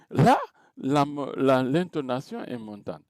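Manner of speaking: ordinary delivery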